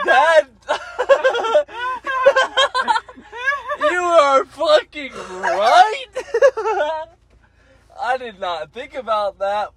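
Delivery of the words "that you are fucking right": shrieking with laughter while talking; cracking up through the words; high, laughing outburst; laughing hard. Real, not acted. Hysterical laughter at a friend's point.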